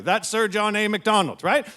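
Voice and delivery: ordinary talk, nothing marked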